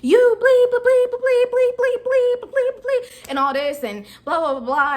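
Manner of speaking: high, loud mock ranting with bleeps; angry customer rant. Customer ranting at the manager, loud high mock rant. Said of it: "he was like".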